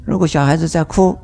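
ordinary talk

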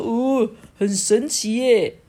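slow, odd-voiced wonder; amazed little boar. A narrator's reading. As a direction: marvel like a little wild boar. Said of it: "小野猪呢也说". (惊奇的小野猪)

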